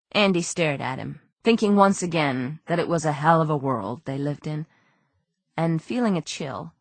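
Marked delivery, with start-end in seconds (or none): none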